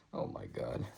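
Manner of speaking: whispered, breath-only amazement; real awestruck whisper; hushed awe. Real, not acted. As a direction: whisper it, floored by what you see. Awed by the photo shown.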